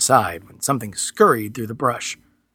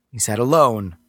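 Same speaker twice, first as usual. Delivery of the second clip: plain reading voice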